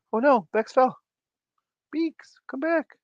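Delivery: very high cutesy dismay; high-pitched mock panic; playful alarm. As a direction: call after it in a squeaky voice. Cries out to fallen plush.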